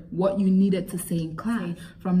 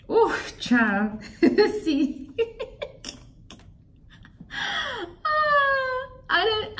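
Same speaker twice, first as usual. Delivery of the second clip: high breathy laughter; laughing in disbelief; 13 semitones higher, much slower than usual. Real, not acted. Looking back at that old job, laughing in disbelief. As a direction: laugh it off, shaking your head.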